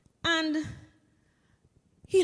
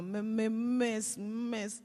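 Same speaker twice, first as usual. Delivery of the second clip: slow, halting schoolboy stammer; imitated nervous stammer; 3 semitones lower, 6 dB quieter, much slower than usual. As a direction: stick on the first sound, then trail off softer. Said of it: "Carl start to stammer".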